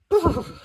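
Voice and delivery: loud high shivery brr; shivering brr. Acted. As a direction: shiver from the cold. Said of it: "shivered Mum".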